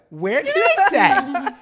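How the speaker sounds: usual speaking voice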